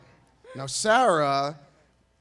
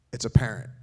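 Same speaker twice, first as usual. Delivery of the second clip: deadpan punchline; 4 semitones lower, 3 dB louder than usual. Shown as a demonstration. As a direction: drop the punchline low and flat, falling. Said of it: "All right".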